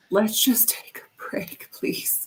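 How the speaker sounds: slow, soft whispered plea; weary pleading whisper. Acted. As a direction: whisper it slowly, like someone begging for rest. Jokingly pleads as tired old host.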